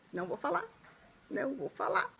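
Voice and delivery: softly, keeping a secret; playful, hushed refusal to tell; coy teasing. Real, not acted. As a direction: tease it under your breath. Teasing viewers about the next video.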